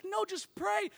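high-pitched imitation of a man in pain; imitated pained cry. Injured father-in-law refusing the ambulance, acted high cry. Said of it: "he's yelling prayers".

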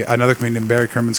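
ordinary talk

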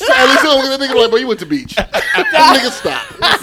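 loud laughter through words; laughing hard. Real, not acted. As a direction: crack up while talking. Laughing at a friend's story.

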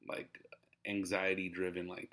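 ordinary talk, nothing marked

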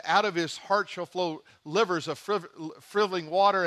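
"livers of friv-, frivoling water" stumbled over mockingly; botched verse. Preacher recalls his slip in Mississippi, tongue-tied mock stumble. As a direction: tangle the words into "livers" and "frivoling". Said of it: "I said it like this".

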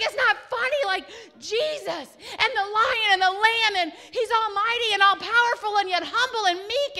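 overwhelmed joyful protest; laughing-crying protest. Retold or imitated. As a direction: plead through tears and laughter.

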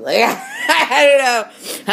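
high, loud laugh through the words. Real, not acted. To listeners about being a goon, laughing.

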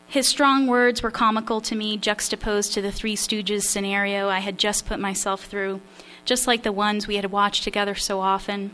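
ordinary delivery

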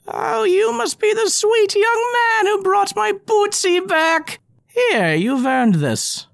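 high, slow, kindly granny voice; sweet old woman. Acted. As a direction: thank him like a sweet grandma.

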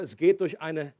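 ordinary talk, nothing marked

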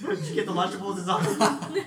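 high question through laughter; laughing tease. Real, not acted. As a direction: tease while still cracking up. Laughing, teasing question in the joke.

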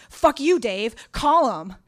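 very loud, high furious scream; an all-out furious scream. Retold or imitated. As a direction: scream it at him. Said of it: "now I'm screaming".

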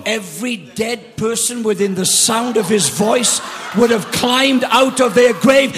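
loud rising sermon shout; full-force preaching. Acted. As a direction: build the church to a climax.

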